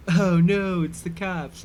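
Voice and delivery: a hint of movie-style party alarm; mild mock panic at the cops; Superbad-style panic. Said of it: "like in Superbad".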